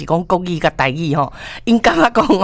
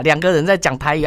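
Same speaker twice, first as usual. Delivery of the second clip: ordinary talk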